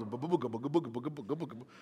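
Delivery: low, soft mock engine sputter; engine knocking noise. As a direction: imitate a dying car knocking like drums. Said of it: "my car started to play the drums".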